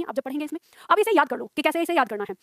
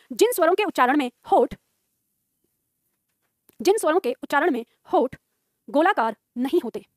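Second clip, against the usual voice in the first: dictation; 2 semitones lower than usual. Acted. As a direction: dictate it phrase by phrase, repeating evenly. Teacher dictates a definition. (रुक-रुककर लिखवाते हुए)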